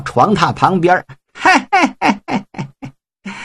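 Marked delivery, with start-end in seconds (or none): 1.4-3.3 high staccato heh-heh, trailing off; creeping, gleeful little snigger; sly chuckle. Acted. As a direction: snicker gleefully in short high bursts. Groom sneaks up to bride, chuckling.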